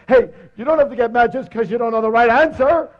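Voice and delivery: high, loud comic whine of complaint; battered lion protesting. Acted. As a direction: protest in a hammed-up, strained high voice.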